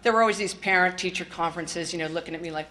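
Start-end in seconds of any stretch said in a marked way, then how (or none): none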